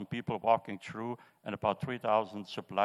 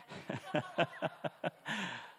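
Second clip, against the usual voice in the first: soft, high, rapid chuckle; quiet high-pitched giggle; real chuckle; 6 semitones higher, 6 dB quieter than usual. Real, not acted. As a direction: laugh softly in quick, high pulses. Laughs at his Mercedes aside.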